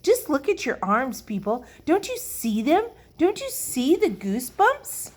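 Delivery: high, urgent rising questions; excitedly insistent. A narrator's reading. Lucille urges the children to check their arms, insistent. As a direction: push them to look, pitch climbing. Girl urges others to look.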